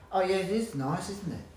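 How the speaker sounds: mock cheerful reply. They go.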